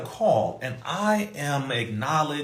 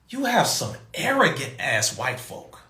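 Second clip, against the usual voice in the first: ordinary delivery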